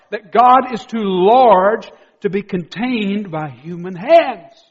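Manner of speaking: loud, high-pitched, driving sermon delivery; full-force preaching. Real, not acted. Preacher urging the congregation not to miss this, full force.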